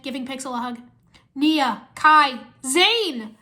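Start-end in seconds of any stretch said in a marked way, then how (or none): 1.3-3.4 loud roll call of friends; joyful calling. A narrator's reading. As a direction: call each name out gladly. Wu greets returning ninja.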